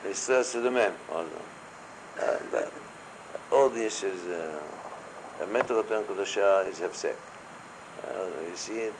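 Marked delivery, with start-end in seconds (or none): none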